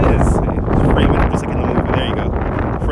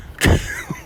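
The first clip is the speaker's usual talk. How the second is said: short squeaky laugh; a quick high chuckle. Real, not acted. Laughs at own confession.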